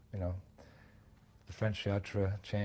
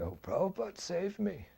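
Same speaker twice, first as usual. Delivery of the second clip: high, simple and grateful; high-pitched heartfelt thanks; Pigpen's grateful voice; 10 semitones higher than usual. Retold or imitated.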